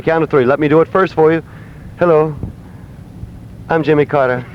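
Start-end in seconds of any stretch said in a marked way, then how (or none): 2.0-4.4 lightly voiced presidential impression; Jimmy Carter. Shown as a demonstration. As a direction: demonstrate a mild mock president, with pauses. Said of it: "the famous President Jimmy Carter impression".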